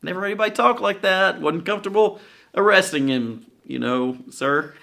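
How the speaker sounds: a bashful guard shrugging it off; high apologetic yokel cop; sheepish police. Retold or imitated. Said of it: "these typical police who are like".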